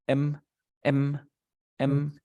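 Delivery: "M" as a short closed-lip "mmm"; M sound. Shown as a demonstration. Teacher showing a student the M, isolated hummed sound. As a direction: hum each "M" separately, evenly. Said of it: "El sonido de la M".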